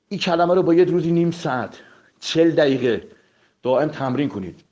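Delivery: normal talking voice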